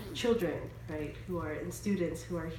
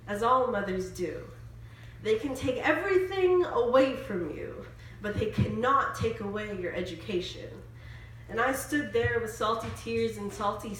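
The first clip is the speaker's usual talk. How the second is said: higher, rhythmic spoken word delivery; spoken word poetry; 4 semitones higher, 5 dB louder than usual. Acted. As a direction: perform it as an emphatic poem. Performs a spoken word poem.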